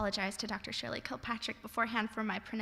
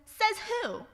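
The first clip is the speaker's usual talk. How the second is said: mock retort; 11 semitones higher than usual. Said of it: "throw your phrase right back at you".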